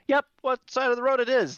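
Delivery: loud, high driver voice; yelling question from the wagon; caravan driver voice. Acted.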